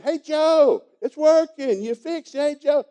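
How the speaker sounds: high, loud excited hollering; retold yelling. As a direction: shout it across the plant floor. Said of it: "They're yelling".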